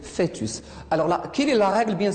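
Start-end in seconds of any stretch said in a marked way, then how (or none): 0.0-0.6 fœtus as "fé-tus"; dictionary pronunciation. Shown as a demonstration. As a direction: say fœtus with "é", not "eu". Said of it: "Si vous cherchez dans le dictionnaire".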